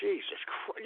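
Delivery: unvoiced whisper; whispered exasperation. Real, not acted. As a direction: breathe it out under the breath. Exasperated aside.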